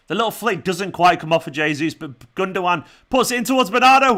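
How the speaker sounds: football commentary. Shown as a demonstration. Streamer calling City's move for viewers, building live commentary. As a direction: commentate the attack, rising towards the chance. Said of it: "I'll do some commentary".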